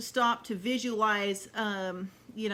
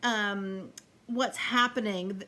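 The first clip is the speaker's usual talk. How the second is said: ordinary delivery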